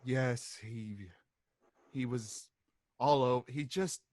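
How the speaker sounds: low, quiet, halting account; low-voiced embarrassed witness; weary witness.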